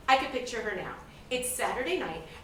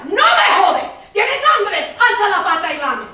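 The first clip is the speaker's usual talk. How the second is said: imitating mom erupting, loud and higher; mother's furious yell; 6 semitones higher, 13 dB louder than usual. Mother yelling at hungry little daughter, furious shout. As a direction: bark it like a volcano blowing. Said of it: "this volcano would erupt and yell".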